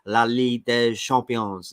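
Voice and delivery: slow, careful French, La Ligue des Champions with a silent S on des; better French accent. Shown as a demonstration. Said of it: "Is that better?".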